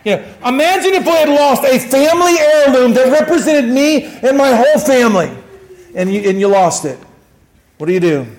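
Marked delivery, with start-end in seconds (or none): 0.4-5.3 high, forceful appeal to the congregation; impassioned preaching. Acted. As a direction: press the point home with rising fervor. Preaches with raised voice.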